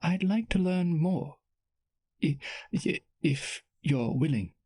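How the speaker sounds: lightly voiced shy princess. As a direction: ask shyly, stumbling.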